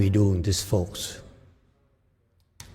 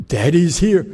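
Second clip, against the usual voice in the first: loud, higher, carefree swagger; fearless bravado; 8 semitones higher, 6 dB louder than usual. Retold or imitated. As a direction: imitate a teacher boldly taking it on. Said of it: "he doesn't care. He say".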